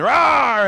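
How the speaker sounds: hammed-up playful growl; playful monster roar. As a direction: roar like a pretend monster chasing children. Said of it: "they sneak back in the room and".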